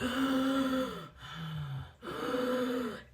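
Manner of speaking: one long, deep rasping gasp for air; retold gasp of a struggling child; imitated labored gasp. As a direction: mimic a child's heavy struggling breath. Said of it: "this really deep labored breathing".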